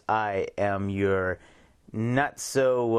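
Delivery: normal talking voice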